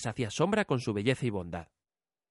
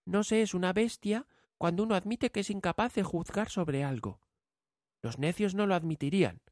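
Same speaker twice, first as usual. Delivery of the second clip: put-on young girl voice; 7 semitones higher than usual. A narrator's reading. Bella answers.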